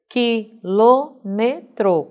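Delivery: "Quilômetro" very slow, as qui, lô, me, tro; a pause after each syllable of "Quilômetro". Shown as a demonstration. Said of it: "quatro sílabas". ("Quilômetro" silabado, bem devagar)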